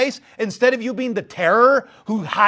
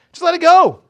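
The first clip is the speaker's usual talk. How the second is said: loud, high burst; shouted plea; 11 semitones higher, 10 dB louder than usual. Real, not acted. Urging the congregation to release grudges, shouted. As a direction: belt it out like a preacher. Preacher's sudden climax.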